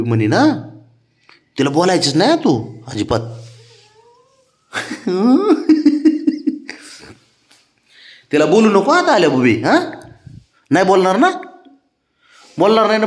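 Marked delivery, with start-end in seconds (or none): 4.7-6.7 high, breathless burst of laughter; big laugh. Real, not acted. Teasing the sulking child, laughs. (खळखळून हसणे)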